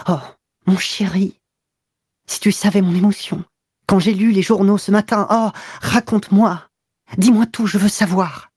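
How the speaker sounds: high, eager woman's voice pressing for news; eager lover's high voice; excited young mistress. A narrator's reading. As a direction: beg him to tell everything, breathless with relief. Clotilde voiced by narrator.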